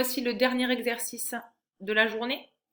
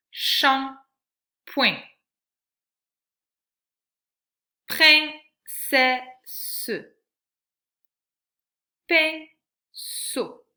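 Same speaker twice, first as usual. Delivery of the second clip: higher, louder, carefully spoken words; teacher sounding out picture words; loud word reading; 4 semitones higher, 7 dB louder, much slower than usual. Shown as a demonstration. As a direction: say each word clearly for a child. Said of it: "en te donnant en fait pour chaque image".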